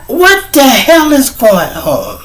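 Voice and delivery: high, drawn-out outraged demand; snapped. Acted. Miss Washington demanding an explanation, high outraged cry. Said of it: "She snapped".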